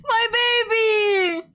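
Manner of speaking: hammed-up sobbing wail, high and loud; mock wail. Player over-mourning a crying game character, mock wailing. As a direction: ham up a heartbroken cry for the character.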